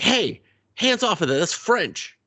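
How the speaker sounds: high-pitched imitation of a bossy kid; mock scolding kid. Kid warning a guest off the wall, high mock scolding. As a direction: squawk it like a protective little host.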